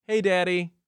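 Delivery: high-pitched imitation of a small child; little daughter. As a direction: imitate a little girl greeting her dad. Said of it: "and she's like".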